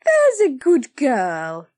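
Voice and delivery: slow, loud, sugary; Blodwin, gushing. Acted. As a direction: patronise the child. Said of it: "cried Blodwin".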